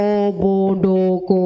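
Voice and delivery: "অ", "ব", "ড", "ক", each held with a short pause after; sounds one by one. Shown as a demonstration. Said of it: "এইভাবে আমরা এখানে বলি".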